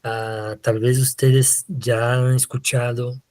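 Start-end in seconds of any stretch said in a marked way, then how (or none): none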